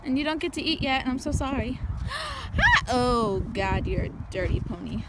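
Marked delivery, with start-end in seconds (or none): 1.8-2.5 gasp. Real, not acted. Greeting the horse.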